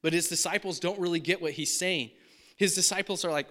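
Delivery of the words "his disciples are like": ordinary talk, nothing marked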